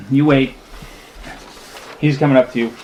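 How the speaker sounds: usual speaking voice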